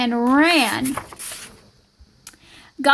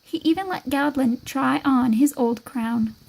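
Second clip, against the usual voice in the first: plain reading voice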